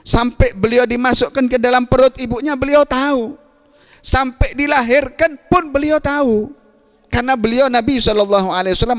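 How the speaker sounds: high, ringing preacher's delivery; fervent preaching. Acted. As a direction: proclaim the perfection of the Prophet with fervour. Preaching at full force. (berkhotbah dengan semangat, suara tinggi)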